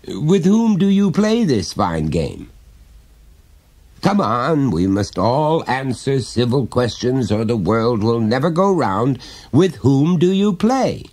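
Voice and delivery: a hint of a chummy dad prying gently; jovial father. Father coaxing his small son to confide, lightly voiced.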